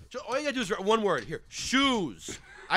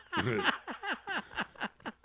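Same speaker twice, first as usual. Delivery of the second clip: a high cackle; high laugh; 11 semitones higher, 8 dB quieter than usual. Real, not acted. Laugh after the lyric.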